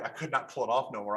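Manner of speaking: ordinary delivery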